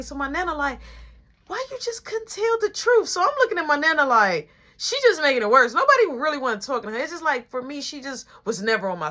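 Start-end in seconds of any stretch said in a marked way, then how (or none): 1.5-3.1 much higher, whiny grandma voice; nagging grandma. Retold or imitated. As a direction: do the nana pitched way up. Nana piles on.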